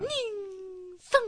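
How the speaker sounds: 日産 split into two long, separated syllables; drawn-out jingle. Shown as a demonstration. Co-host testing the car maker's name, drawn-out jingle. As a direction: hold each half of 日産 like a ringing echo.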